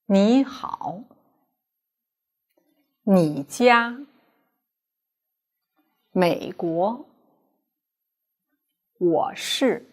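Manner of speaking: 你好 with 你 rising as a second tone before the dipping 好; slow model reading of 你好, 你家, 美国, 我是 for learners to copy; teacher modelling tone sandhi clearly; careful Mandarin tone demo. Shown as a demonstration. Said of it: "listen to me and try to find out how the tone changes".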